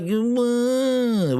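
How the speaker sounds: slow, drawn-out whimpering hum; a babyish whine with no words; a slow mock whimper. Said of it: "they're all whining".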